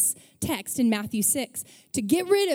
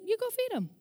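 much higher, soft, amused repeat; calm Jesus repeating; 10 semitones higher, 4 dB quieter, faster than usual. Retold or imitated. Jesus repeating the order to his disciples, high and soft. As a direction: say it again, lighter and gentler. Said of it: "He's like".

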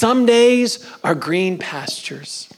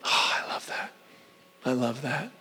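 softer, hushed delight; whisper; 3 semitones lower, 10 dB quieter than usual. Real, not acted. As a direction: murmur it as if moved. Preacher moved by verse.

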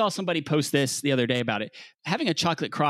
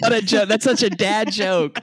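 mock outrage; 7 semitones higher, 6 dB louder than usual. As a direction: call out the dad joke with a big fake groan. Mock outrage at carrot cake pun.

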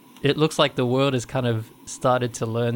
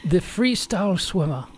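wildlife narrator voice, higher pitched; nature documentary; 8 semitones higher, 4 dB louder, slower than usual. Pretend. Parodies athletes as wildlife.

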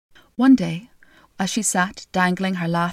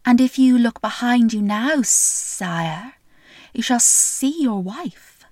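slow, sly snake voice; a lightly voiced, unhurried snake; 3 semitones higher, 3 dB louder, slower than usual. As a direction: draw out every word like a slithering snake. Snake voiced in fairy tale.

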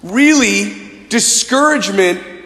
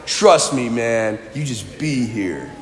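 lightly voiced chill snowboarder dude; low, easy bro delivery; surfer-dude talk; 8 semitones lower, 5 dB quieter, slower than usual. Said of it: "why they talk like that".

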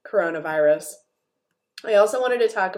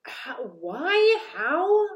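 high-pitched, exaggerated puzzlement; mock bafflement; 6 semitones higher, much slower than usual. Host reacting to the guest-hosting choice, mock baffled. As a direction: act out being utterly baffled.

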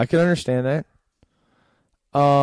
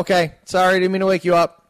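raised voice to someone in another room; calling out; 8 semitones higher, 7 dB louder than usual. Real, not acted. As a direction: call out loud across the house. Calling out to wife elsewhere.

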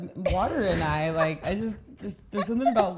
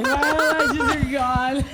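slow, high, loud wail through laughter; mock despair, laughing it out; drawn-out laughing lament; 8 semitones higher, 6 dB louder, much slower than usual. Retold panic over lost eyelashes.

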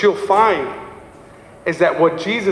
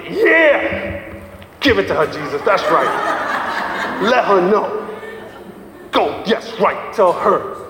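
mock cheering; slower than usual. Preacher mimics congregation cheering Jesus on, mock hype. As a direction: cheer like a rowdy fan in the pews.

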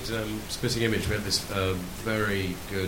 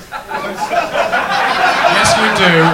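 high, loud Australian bloke; loud laughing Australian accent; Aussie joke voice; 8 semitones higher, 12 dB louder, much slower than usual. Pretend. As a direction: deliver the punchline as a brash Aussie. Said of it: "goes up to this Sheila and he says".

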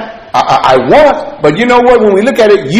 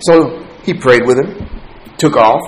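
usual speaking voice

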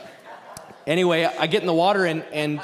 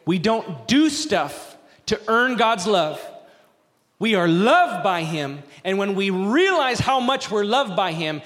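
louder, slow, pressing sermon voice; fervent preaching; 5 semitones higher, 3 dB louder, slower than usual. Acted. Pastor pressing grace on the congregation, fervent. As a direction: drive the point home from the pulpit.

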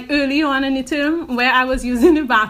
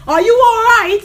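raised, high call through a door; mock concerned call; 8 semitones higher, 6 dB louder than usual. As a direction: imitate a man checking on someone. Acts out man calling through door.

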